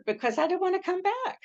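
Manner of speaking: high-pitched playful complaint; retold protest. Retold protest at being sent back.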